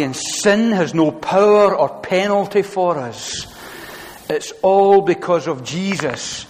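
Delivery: loud, driving sermon voice, every phrase hammered; full-force preaching. Acted. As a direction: preach it out to the whole hall with rising conviction.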